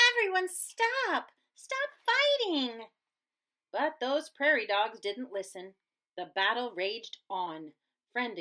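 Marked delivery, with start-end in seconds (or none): 0.1-2.9 high, slow, louder cry; pleading shout. Acted. Pip trying to end the war, high pleading shout. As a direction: beg them to stop.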